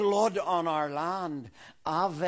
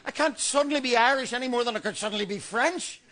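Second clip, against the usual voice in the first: mock panic; 6 semitones higher, faster than usual. Panic at the terrifying thought.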